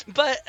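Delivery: loud, high burst of laughter; real laugh. Real, not acted. Vlogger laughing at himself for praising John Cena, real laugh. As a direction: laugh it off, caught out. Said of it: "I don't wanna compliment John Cena".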